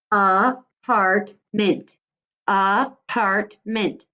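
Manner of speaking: Apartment stretched very slowly, syllable by syllable; slow syllable demo. Shown as a demonstration. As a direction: model Apartment slowly for children to echo. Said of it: "Apartment modeled again".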